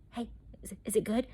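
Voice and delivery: whispered, tentative coworker question; hushed asking. Retold or imitated. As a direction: whisper it as if checking discreetly. Coworkers asking cautiously, retold.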